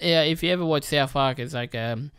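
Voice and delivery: ordinary talk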